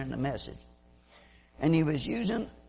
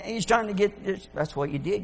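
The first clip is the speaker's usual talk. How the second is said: ordinary talk, nothing marked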